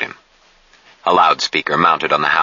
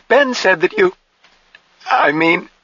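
put-on very high, faltering young woman; on the verge of sobbing. A narrator's reading. Jill protests it's not a corpse, nearly sobbing. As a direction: break off and restart, close to tears. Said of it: "put her head down and sobbed".

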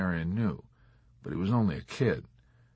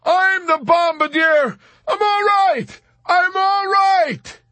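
very high, loud, frantic shout; crying back; 9 dB louder, slower than usual. A narrator's reading. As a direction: scream back to make him understand. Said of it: "cried back at him".

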